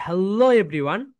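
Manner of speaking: very slow "Hello" with a big swell on "-lo"; stretched stress on Hello. Shown as a demonstration. Teacher shows how to carry the greeting, stretched stress on "Hello". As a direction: carry "Hello" up and over, lifting the end of "everyone". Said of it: "এইরকম ভাবে নিয়ে যাবে".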